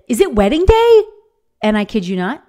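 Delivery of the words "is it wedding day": put-on higher and louder, bursting excited child; a kid thrilled it's finally the day; eager little boy. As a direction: her son on the wedding morning, excited high child voice.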